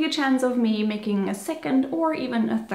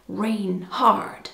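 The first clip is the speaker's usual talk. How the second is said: "Reinhard" in an American accent, "rain hard"; 4 semitones lower than usual. Shown as a demonstration. Joking on how Americans would read the name "Reinhard", American accent. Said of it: "Or should I say".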